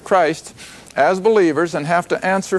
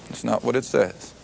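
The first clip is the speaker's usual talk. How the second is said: a soft aside, that's not what it says; quiet flat correction; 5 semitones lower, 8 dB quieter than usual. Acted. Preacher correcting a misquoted verse, quietly firm. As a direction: drop soft, firmly denying the misreading. Refuting wrong readings of verse.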